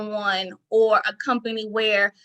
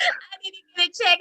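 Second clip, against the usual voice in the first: pitched up high, incredulous, amused; higher amused repeat; 8 semitones higher than usual. Real, not acted. Guest repeating the punchline to host, pitched up high in amused disbelief.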